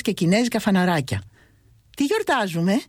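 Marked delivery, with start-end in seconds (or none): 2.0-2.8 puzzled. A narrator's reading. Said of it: "την αρώτηξα παραξενευμένος".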